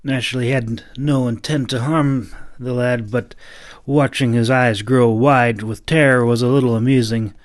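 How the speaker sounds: ordinary delivery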